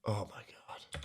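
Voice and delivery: breathy whispered disbelief; stunned whisper. Real, not acted. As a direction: barely voice it, stunned. Stunned reaction to stream.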